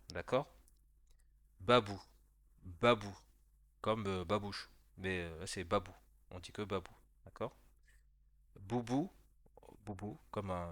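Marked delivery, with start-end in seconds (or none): 1.6-3.2 Babou in two clear syllables, "ba-bou"; model for repeating. Shown as a demonstration. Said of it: "essaye de répéter après moi". 8.6-9.1 Boubou in two clear syllables, "bou-bou"; model for repeating. Shown as a demonstration. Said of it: "essaye de répéter après moi".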